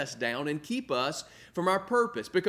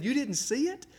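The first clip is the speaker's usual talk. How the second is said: high, incredulous "you missed it?"; disbelieving that he missed it, voiced high; retold surprise; 9 semitones higher than usual. Adults on the team surprised the pastor missed it, higher and incredulous.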